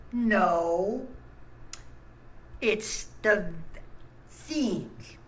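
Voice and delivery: slow, drawn-out correction; emphatic self-quote. Retold or imitated. Vlogger retelling his own reply, slow and emphatic. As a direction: stretch each word out for emphasis. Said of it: "What I said was".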